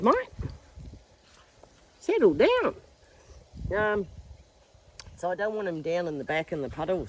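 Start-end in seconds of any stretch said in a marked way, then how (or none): 2.0-2.7 high, loud scolding call; calling to dogs. Real, not acted. Owner to dogs playing roughly, real high scolding call. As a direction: call sharply to settle the animals.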